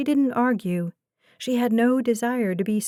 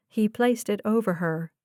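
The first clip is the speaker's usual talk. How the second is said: ordinary delivery, nothing marked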